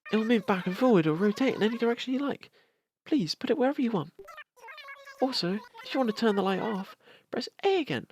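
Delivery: high, bright character read; cheerful game character voice. Acted. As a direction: voice the shopkeeper sweetly. Reads game character dialogue.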